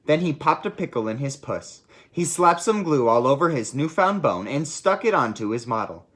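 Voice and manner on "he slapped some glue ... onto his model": plain reading voice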